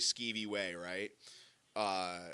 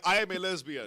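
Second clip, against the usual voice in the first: lightly put-on voice, higher and louder; man voice; 6 semitones higher, 5 dB louder than usual. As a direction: imitate the regular's declaration. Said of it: "in his man voice he was like".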